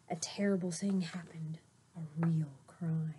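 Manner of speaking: hushed, secretive whisper; suspense whisper. Acted. Teacher teasing the coming crime to kids, whispering. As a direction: lean in and whisper the bad news.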